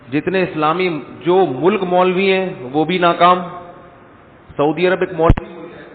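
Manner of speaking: usual speaking voice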